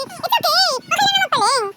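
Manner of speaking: high, upbeat comforting; emphatic reassurance. Real, not acted. Parent reassures child after slip.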